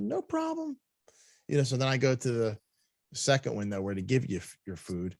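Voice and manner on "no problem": mock polite. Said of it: "I said".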